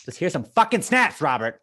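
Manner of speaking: playful outburst at a co-host; mock scolding. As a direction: jokingly demand it, loudly. Mock scolding co-host for not snapping.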